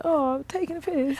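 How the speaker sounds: mock British accent. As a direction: put on a silly British accent. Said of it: "say silly things like".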